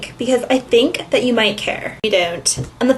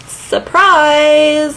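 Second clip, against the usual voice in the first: sarcastic surprise; 4 semitones higher, 4 dB louder than usual. Acted. Sarcastic exclamation.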